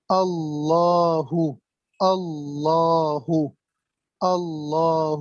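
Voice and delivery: اللہ drawn out very slowly, with the doubled lam held; very slow teaching read of اللہ; shadda demo. Shown as a demonstration. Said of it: "لام مشدد ہے".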